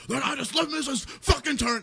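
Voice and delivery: high-pitched garbled mock rant; losing his mind. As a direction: imitate someone losing it on the phone. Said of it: "he's losing his mind".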